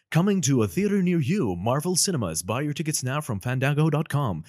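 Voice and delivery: high, loud trailer announcer; promo trailer voice. Shown as a demonstration. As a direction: hype the ticket sale. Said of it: "always the last part is".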